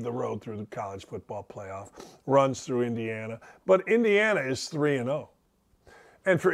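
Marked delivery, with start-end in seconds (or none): 0.0-3.4 low, hushed, far-off voice of denial; eyes-closed disbelief. Pretend. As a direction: mumble it like you can't believe it. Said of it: "Why did I close my eyes?".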